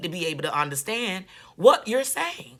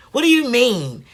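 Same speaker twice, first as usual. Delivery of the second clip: ordinary delivery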